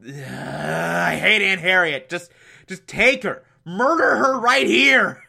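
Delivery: sighing, fed-up rant; exasperated. Real, not acted. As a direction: sigh then plead in mock despair. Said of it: "I hate that character so much".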